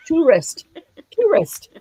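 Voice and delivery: high "tourist" said as TOO-rist; a playful TOO-rist; mock pronunciation. Shown as a demonstration. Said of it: "that sounds as bad to me".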